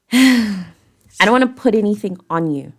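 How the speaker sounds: ordinary delivery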